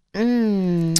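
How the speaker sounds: langgerekt gespeeld 'mm'; genietend gekreun. Acted. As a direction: kreun met gesloten mond. Genietend geluid tijdens verhaal. (aangehouden genietende zucht)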